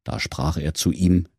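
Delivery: plain reading voice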